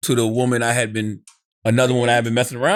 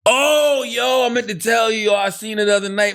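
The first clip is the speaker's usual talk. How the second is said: excited news; 7 semitones higher than usual. Retold or imitated. Wax bursting in with news.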